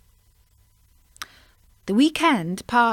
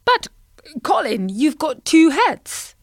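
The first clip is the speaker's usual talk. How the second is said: croaking frog; 6 semitones higher, 5 dB louder than usual. Acted. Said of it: "until Bertie croaked".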